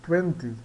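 "Twenty" with the W said like a U, "tuenti"; teacher modelling "Twenty"; pronunciation demo. Shown as a demonstration. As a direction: say "Twenty" as "tu-enti", W as an oo sound. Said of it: "Nós temos esse W, que ele soa como a vogal U".

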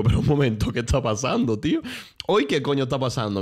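loud, disbelieving what's going on; half-acted alarmed exclamation; retold shocked outburst. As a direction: blurt it out like you can't believe the morning. Retold shock at mother's fall.

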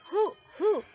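high, round storybook hoots; owl hooting. A narrator's reading. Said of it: "Owl said".

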